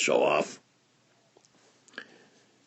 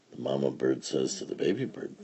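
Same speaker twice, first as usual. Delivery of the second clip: usual speaking voice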